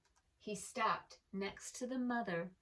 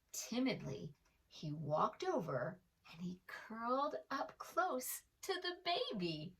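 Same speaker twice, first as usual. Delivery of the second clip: ordinary delivery, nothing marked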